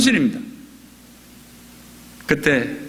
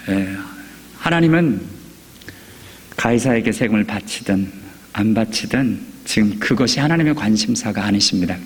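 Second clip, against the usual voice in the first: usual speaking voice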